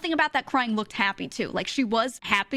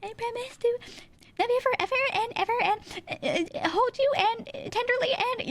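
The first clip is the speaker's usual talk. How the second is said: very high, halting mock bride voice; tearful bride reading vows; 13 semitones higher than usual. Imitating the bride reading her vows, high halting voice. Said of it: "the bride is standing there reading her vows".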